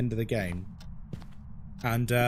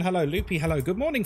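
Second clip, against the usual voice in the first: ordinary delivery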